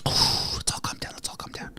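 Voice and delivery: soft whispered self-calming, fading away. Real, not acted. A streamer whispering to calm himself and his viewers down. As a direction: whisper it to settle yourself.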